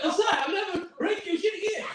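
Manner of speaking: put-on high pleading child voice; pleading kid. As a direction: beg like a scared kid.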